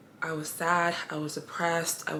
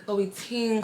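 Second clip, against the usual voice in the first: playful emphasis; 5 semitones higher than usual. Shown as a demonstration. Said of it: "playful repetition of the name".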